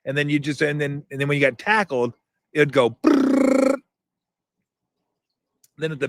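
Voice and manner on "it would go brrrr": high, slow electronic brrr; buzzing noise imitation. Shown as a demonstration. Showing viewers the game's tackle sound, high buzzing brrr. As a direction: mimic the old handheld buzzing.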